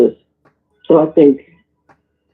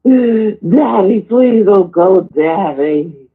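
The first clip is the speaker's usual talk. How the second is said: put-on high, slow whining child's plea; mock crying plea; 6 semitones higher, much slower than usual. Boy begging his father to stay, mock whining. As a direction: imitate a sobbing boy begging his dad not to leave. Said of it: "And he would go".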